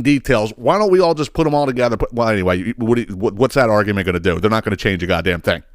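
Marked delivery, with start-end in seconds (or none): none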